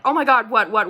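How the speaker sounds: alarmed questions. Retold or imitated. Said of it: "And I'm like".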